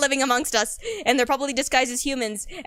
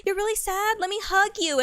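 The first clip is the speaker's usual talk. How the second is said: imitating a sympathetic date, lightly higher; high mock sympathy; 8 semitones higher than usual. A love interest offering a hug, mock high sympathy. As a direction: coo over them in a put-on high voice. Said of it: "they'll be like".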